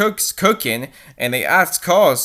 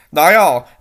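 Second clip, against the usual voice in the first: ordinary delivery, nothing marked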